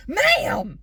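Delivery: loud imitated yell. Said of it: "Why is he yelling at me".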